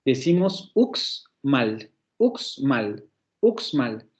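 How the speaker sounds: uxmal said slowly, each sound clear, uks-mal; slow clear pronunciation. Shown as a demonstration. Teacher models uxmal slowly for the class. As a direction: spell out uxmal slowly, showing the ks. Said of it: "dos consonantes de coda".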